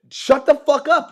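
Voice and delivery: yelled high and loud; loud yell telling a stubborn student to shut up; shout. Acted. Yelling at imagined student.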